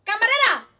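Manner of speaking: put-on loud drunken shout for the waitress; drunk yelling. Drunk man in a bar yelling for the waitress, loud. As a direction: bellow it across the bar. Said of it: "empieza a gritar fuerte".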